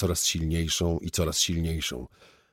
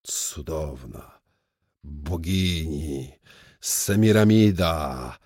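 very slow, drawn-out adoration, building louder; enraptured excitement; 4 dB louder, much slower than usual. Acted. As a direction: savour each word, swelling in rapture. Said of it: "ekscytował się Suchy". (przeciągły, narastający zachwyt)

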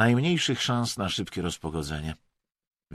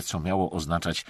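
ordinary delivery, nothing marked

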